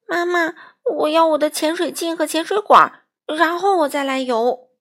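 put-on high, loud childlike plea. A narrator's reading. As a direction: cry out to mum, acted and urgent. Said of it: "哭叫着". (高声哭叫着向妈妈求助)

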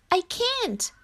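high-pitched, downcast frog voice; high little voice of a glum frog; sad Frog. A narrator's reading. Gloomy Frog refuses to cheer up.

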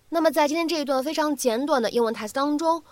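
ordinary talk

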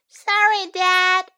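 put-on high, loud little-boy apology; child apologizing to dad; child's sorry. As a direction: blurt out sorry as a kid. Child apologizes after mower mishap.

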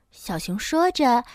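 ordinary delivery

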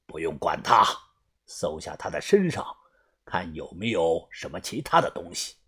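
low, soft cold order; stern low boss. Acted. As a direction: give the order coldly, frowning. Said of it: "皱着眉说道".

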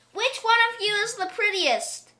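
high call to a crowd. A narrator's reading. Old man asks all the cats, calling out high. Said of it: "he called to the cats".

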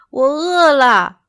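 high, loud, drawn-out whine; childlike monkey grumble, stretched out; complaining. A narrator's reading. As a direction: whine it slowly like a hungry little monkey. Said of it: "奥东奥抱怨着".

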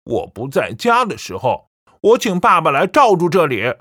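firm and loud, a stern warning; loud declaration. A narrator's reading. Son warning his mother about father's photo, firm loud. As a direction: lay down the law to her. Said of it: "小宝大声说".

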